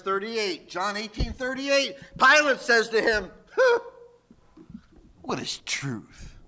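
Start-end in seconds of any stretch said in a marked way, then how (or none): 3.6-6.4 a scoffing huh, then low, slow and hushed; sour, dismissive scoff. Acted. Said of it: "You're turned into some sourpuss".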